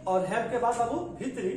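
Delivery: ordinary talk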